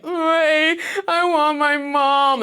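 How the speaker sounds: put-on high, slow, loud crying child; whiny kid voice. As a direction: sob it like a scared kid.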